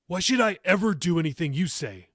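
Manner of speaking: defiant declaration. A narrator's reading. Teen grandson defying his grandmother, loud and defiant. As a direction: throw it at her like a challenge. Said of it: "he declared".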